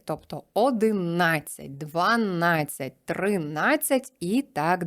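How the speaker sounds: одинадцять, дванадцять, тринадцять said slowly with stress on на before -дцять; slow stress on -адцять. Shown as a demonstration. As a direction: lay them out slowly, stressing на each time. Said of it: "наголошуються на ацять". (повільно, наголос на «на» перед -дцять)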